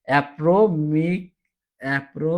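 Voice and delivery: "অ্যাপ্রোমিক" dragged out slowly, then cut off at "অ্যাপ্রো"; struggling pronunciation. Shown as a demonstration. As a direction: slowly fight through "অ্যাপ্রোমিক" and give up. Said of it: "উচ্চারণ করতে গেলে কষ্ট হয়". (কষ্ট করে ধীরে "অ্যাপ্রোমিক" উচ্চারণের চেষ্টা)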